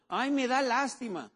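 high, slow mocking whine of pity. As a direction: act out a whiny "ay, me da lástima". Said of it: "No lástima, compasión".

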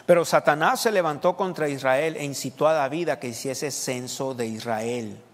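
loud verse reading that slows at the close; emphatic scripture reading. Real, not acted. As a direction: proclaim the verse to the congregation. Reads the verse aloud again. (lectura bíblica proclamada con fuerza)

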